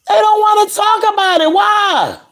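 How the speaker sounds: high, shouted refrain, fed up; ranting shout. Real, not acted. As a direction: yell the complaint out in frustration.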